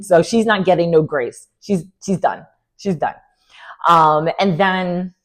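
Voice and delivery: ordinary delivery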